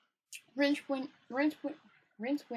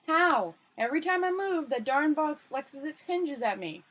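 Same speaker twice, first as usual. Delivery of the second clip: exasperated; 5 semitones higher, 5 dB louder than usual. Acted. Rincewind frustrated with the luggage.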